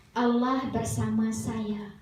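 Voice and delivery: slow, drawn-out model phrase; prompting children. Acted. Father leading his children's bedtime words, slow prompting. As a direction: say it slowly for children to repeat.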